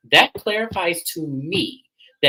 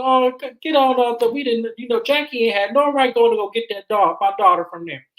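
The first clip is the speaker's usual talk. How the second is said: mock indignant husband; 10 semitones higher, 3 dB louder than usual. Voicing Bubba defending himself.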